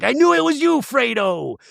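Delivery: very high, dramatic movie-quote cry; Godfather-finale parody. Acted. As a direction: cry out a mock betrayal scene. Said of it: "like, the end of The Godfather".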